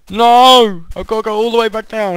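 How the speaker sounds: high and loud, then a fast rushed complaint; exasperated yell. Real, not acted. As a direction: cry out, then rant quickly. Loses progress, yells.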